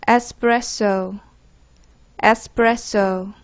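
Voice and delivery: 에스프레소 drawn out slowly, syllable by syllable; pronunciation model. Shown as a demonstration. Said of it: "개프쌤의 발음을 듣기 전에". (에스프레소를 천천히 또박또박 발음)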